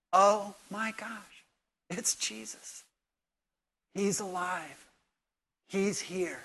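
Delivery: soft, breathless wonder, slow; a quiet gasp of recognition; hushed awe. Acted. Mary realizing the risen Jesus stands before her, in soft awe.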